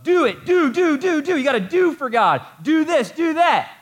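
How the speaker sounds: very high, loud mock nagging; hammed-up taskmaster yelling commands; frantic demanding. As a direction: hammer out the orders at a high-pitched shout. Acting out the works-driven mindset.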